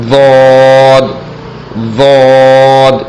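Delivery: ضاد with the tongue side against the molars, heavy. Shown as a demonstration. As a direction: press the tongue edge for ضاد. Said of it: "زبان کی کروٹ دائیں طرف".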